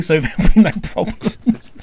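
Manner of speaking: ordinary delivery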